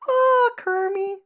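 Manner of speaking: high, drawn-out puppet pig falsetto; Miss Piggy. Acted. Pig diva calling to her frog, high drawn-out falsetto. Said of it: "my Miss Piggy impression".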